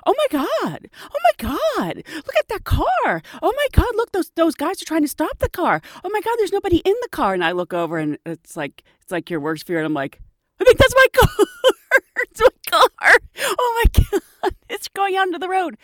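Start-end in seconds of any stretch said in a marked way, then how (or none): 0.0-7.3 high, frantic retold panic; panicked shouting. Panic watching runaway car. 10.6-15.8 high, loud panic with a laugh; panicked realization. Retold or imitated. Realizing it may be her car.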